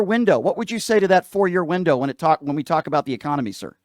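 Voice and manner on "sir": ordinary delivery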